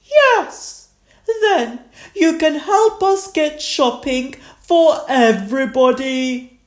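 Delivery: high storybook granny voice; Granny Pig. A narrator's reading. Granny Pig invites the children shopping, high-voiced. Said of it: "Granny Pig said".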